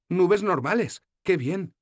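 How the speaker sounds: enjoy the ordinary clouds; voiced young heroine, content. Sefawin voiced by narrator.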